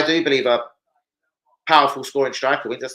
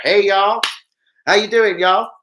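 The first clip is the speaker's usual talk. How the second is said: louder, higher mock Southern US drawl greeting; hammed-up Southern US accent, a friendly "y'all"; 5 semitones higher, 6 dB louder, slower than usual. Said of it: "just for the accent".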